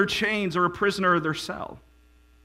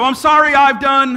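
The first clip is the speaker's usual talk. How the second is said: mock sheepish apology; 9 semitones higher, 10 dB louder than usual. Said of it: "And we go".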